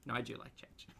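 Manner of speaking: breathy aside whisper. Real, not acted. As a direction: whisper it like a confession. Joking whispered self-correction.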